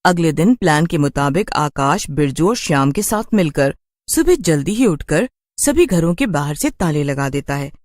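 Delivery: ordinary delivery, nothing marked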